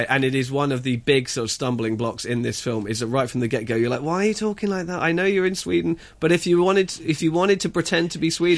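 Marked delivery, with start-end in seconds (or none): 4.0-8.6 high-pitched, incredulous complaint to the actors; exasperated protest. Acted. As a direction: protest in a raised pitch, baffled. Acted protest at the film's accents.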